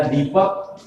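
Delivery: दीपक with the ī lengthened; long first vowel. Shown as a demonstration. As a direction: linger on "dee" in दीपक. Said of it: "उसको आराम से कहा जा रहा है".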